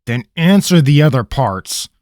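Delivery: loud, firm demand; a stern ranger grilling a prisoner; stern interrogator. Acted. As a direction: press the captive for answers. Rabineau interrogating Pickett.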